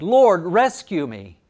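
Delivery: loud, drawn-out cry for rescue; shouted plea. A narrator's reading. Peter calling to Jesus as he sinks, shouted plea. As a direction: call out slowly, high and loud. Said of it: "he shouted".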